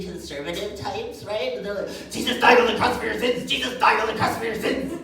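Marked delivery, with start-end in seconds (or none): 2.1-5.0 loud hammed-up preaching; mock preacher shout. Mocking fundamentalists, shouting hammed-up sermon lines. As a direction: bellow it like a street preacher.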